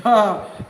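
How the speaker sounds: a quick burst of real laughter; short laugh. Real, not acted. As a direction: laugh out at the joke. Laughs at her joke.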